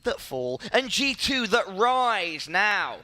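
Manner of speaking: end-of-map caster call, drawn out for the crowd; caster sign-off. Acted. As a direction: wrap the map up like a broadcast host.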